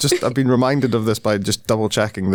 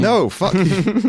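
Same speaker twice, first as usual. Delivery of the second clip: mock retort; 9 semitones higher, much slower than usual. Mock Mario reply to Pratt.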